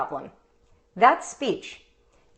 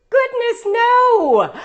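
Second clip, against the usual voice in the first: high, louder delighted exclaim; a mother overjoyed at her child; excited; 12 semitones higher, 6 dB louder, much slower than usual. Retold or imitated. Mother's excited reaction retold.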